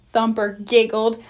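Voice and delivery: plain reading voice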